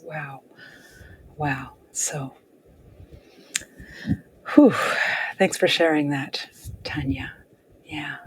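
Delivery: soft, low, half-whispered thanks with pauses; moved and hushed. Real, not acted. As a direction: exhale and gently take in someone's grief. Moved by listener's grief for mother.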